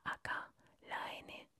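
ordinary delivery

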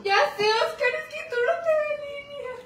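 high, loud crying words. Real, not acted. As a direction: sob the words out for real. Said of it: "está llorando de verdad".

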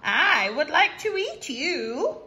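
high, loud cat yowl then slow; a hungry cat calling. Acted. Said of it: "meowed the cat".

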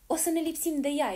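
high, loud determined outburst; a boy defiantly brushing off the problem; eager exclamation. Acted. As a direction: bold excited cry. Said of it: "exclamă băiatul".

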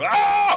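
loud high yelp. Pretend. Streamer doing a repeating gag, loud high yelp. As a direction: break off with a yell.

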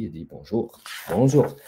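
plain reading voice

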